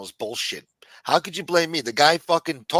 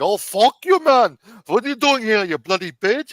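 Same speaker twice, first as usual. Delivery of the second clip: loud, much higher mock outburst, hammed-up; mock hostile greeting; 10 semitones higher, 8 dB louder than usual. Host picturing greeting a rival guest with a loud mock insult. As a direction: yell it as a pretend insult, hammed-up. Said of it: "I didn't say none of that".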